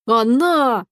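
ordinary delivery

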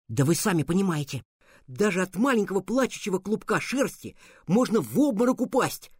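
plain reading voice

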